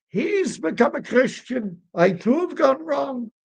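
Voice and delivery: very high, hammed-up wail; wailing old rabbi. Old rabbi confessing his own son converted, wailing. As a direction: lament in a squeaky falsetto with swooping phrases. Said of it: "And the old Rebbe".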